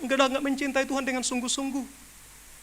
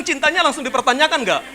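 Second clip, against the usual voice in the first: ordinary talk, nothing marked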